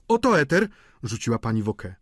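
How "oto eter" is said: high, loud curt announcement; rzucone przez gospodynię. A narrator's reading. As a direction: toss it out in a raised voice. Gospodyni wraca z eterem. (wysoki, głośny głos gospodyni)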